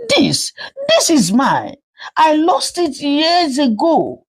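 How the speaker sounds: put-on shocked young woman. Amarachi recognizes her lost wrapper.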